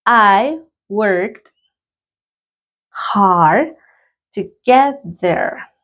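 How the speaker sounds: slow, careful English sentence; slow teacher dictation; slow dictation reading. Shown as a demonstration. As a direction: read it slowly for students to repeat. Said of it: "Number three".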